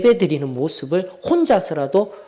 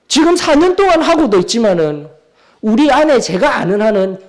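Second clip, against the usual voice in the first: raised-pitch, pressing sermon delivery; forceful preaching; 6 semitones higher, 4 dB louder than usual. Real, not acted. Preacher challenging congregation on reading the whole Bible, forceful. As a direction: press the congregation hard with pointed questions. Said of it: "이렇게 강하게 시작할 수밖에 없어요".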